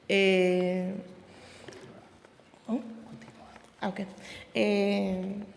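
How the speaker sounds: nervous whisper. Real, not acted. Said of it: "estoy nerviosa".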